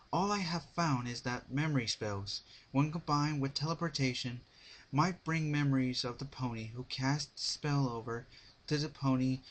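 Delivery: a hint of a frustrated young mare digging for answers; worried Twilight. A narrator's reading. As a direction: Twilight venting to Pinkie, mildly exasperated. Twilight voiced as a character.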